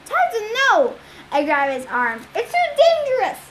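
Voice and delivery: high, loud pleading shout; alarmed shout. A narrator's reading. Percy stopping his brother Tyson from going, shouting. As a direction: grab him and shout him back.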